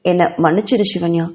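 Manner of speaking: ordinary delivery, nothing marked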